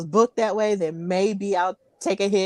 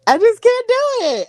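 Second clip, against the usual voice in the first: high-pitched protest; 13 semitones higher, 4 dB louder than usual. Real, not acted. Mock protest about taking hits.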